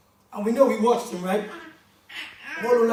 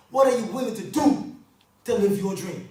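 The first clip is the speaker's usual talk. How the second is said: ordinary talk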